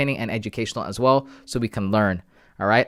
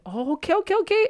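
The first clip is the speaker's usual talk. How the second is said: squeaky high surprised okays. Real, not acted. Streamer reacts to a chat name, surprised squeak. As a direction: react like caught off guard.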